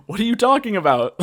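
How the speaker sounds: high, loud incredulous question; mock bafflement. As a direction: act out baffled disbelief, half amused. Voicing Warren's baffled reaction.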